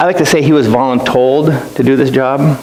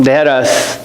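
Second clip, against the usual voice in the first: usual speaking voice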